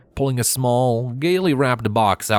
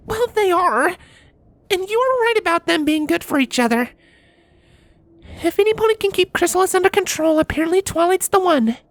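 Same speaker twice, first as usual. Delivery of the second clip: put-on high young mare voice, lightly teasing; a princess pony, high and easy; Cadance voice. A narrator's reading. Cadance chatting with her husband Shining Armor, high mare voice.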